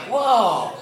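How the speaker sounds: high, loud drawn-out whoa; astonished. Acted. Shopper finding the money back in the bag, astonished. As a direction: exclaim in mock amazement.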